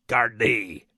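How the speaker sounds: Gardaí breathed low, Munster Irish way; Munster Irish pronunciation. Shown as a demonstration. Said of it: "the Munster dialect".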